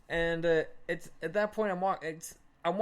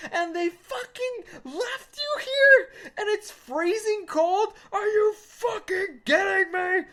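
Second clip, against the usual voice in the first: really worked up; 10 semitones higher, 5 dB louder than usual. Retold or imitated. Storyteller re-enacts anger over abandoned friend, escalating to yelling. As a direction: pile up outrage, each charge climbing higher. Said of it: "So I'm getting really worked up".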